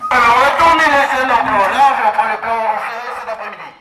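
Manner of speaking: loud mocking imitation of the director; mocking parrot. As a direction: parrot his words to tease him. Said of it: "mocking repeat of the director".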